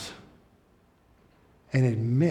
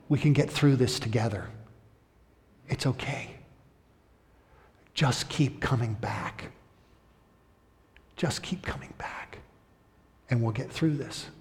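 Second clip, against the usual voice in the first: soothing comfort; 6 dB quieter than usual. Retold or imitated. Comforting a sobbing newcomer.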